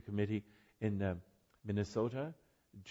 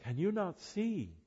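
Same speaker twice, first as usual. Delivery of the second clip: put-on slow, higher, puzzled child's question; imitating a little boy; 10 semitones higher, slower than usual. Little boy asking the blind storyteller, higher and slow. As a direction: ask it like a baffled toddler. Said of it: "He said".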